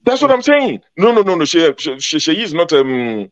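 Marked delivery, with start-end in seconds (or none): 0.0-1.4 higher-pitched, insistent protest; emphatic insistence. Real, not acted. Speaker to co-panellist who corrects the family line, emphatic insistence. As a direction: cut in to set them straight.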